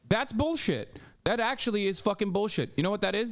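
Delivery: high, indignant rant; heated outrage. Real, not acted. Streamer ranting at chat, pitch climbing, indignant. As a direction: call it bullshit with rising heat.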